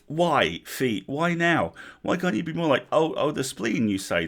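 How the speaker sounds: high, loud comic exasperation; exasperated protest. A narrator's reading. Sergeant scolds her feet for charging the wizard, exasperated. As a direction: wail in protest at your own body.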